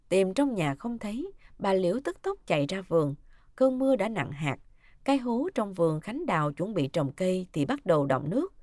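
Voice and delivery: ordinary delivery, nothing marked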